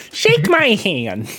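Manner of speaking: whiny nerd trying to sound tough. Retold or imitated. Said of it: "general nerd voice".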